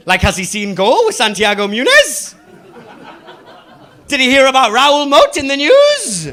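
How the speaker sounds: high, escalating disbelief; performed outrage climbing in pitch; incredulous rant. Acted. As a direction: build to a loud, baffled outburst. Incredulous rant at salesman's knowledge.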